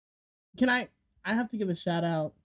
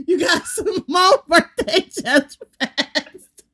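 laughing; 10 semitones higher, 10 dB louder, much slower than usual. Real, not acted. Teasing friend about her birthday.

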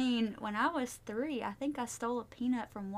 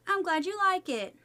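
ordinary talk

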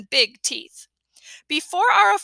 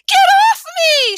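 high, loud panicked yell; woman in red suit shrieking at Fudge; shouted; 10 dB louder than usual. A narrator's reading. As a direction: scream at the kid to get off. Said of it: "she shouted".

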